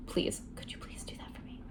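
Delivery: soft whispered pleading; begging whisper. Retold or imitated. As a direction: beg her in a hush. Jax begging softly.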